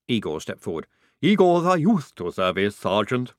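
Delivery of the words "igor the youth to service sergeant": high, loud, oddly thick character voice; Igor's voice. A narrator's reading. Recruit volunteering to the sergeant, in an odd servant's voice. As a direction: offer yourself eagerly as an odd servant.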